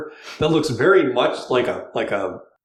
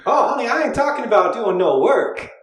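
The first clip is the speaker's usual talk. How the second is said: put-on flirt hard with the cat in a Southern accent; high, bawdy Southern flirt; flirty Southern accent; 9 semitones higher than usual. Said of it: "trying to find an accent for her".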